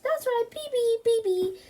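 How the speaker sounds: put-on big brother praising baby talk; coaxing boy. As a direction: encourage the toddler. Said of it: "nodded Bertie".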